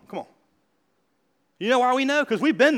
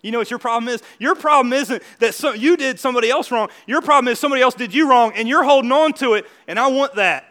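ordinary delivery

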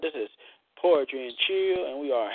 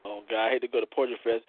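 normal talking voice

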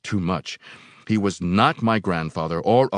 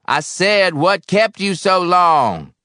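louder, slower, drawn-out demand; insistent angry repeat; 13 semitones higher, 10 dB louder, slower than usual. A narrator's reading. Old boiler man repeating to his silent helper, angrily insistent. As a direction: drag the question out, high and loud.

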